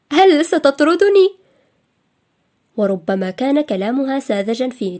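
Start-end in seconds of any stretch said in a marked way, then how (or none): 0.1-1.3 high, louder alarmed question. A narrator's reading. Tearful employee asks whether her boss will fire her, alarmed. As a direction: voice jumps up in dismay.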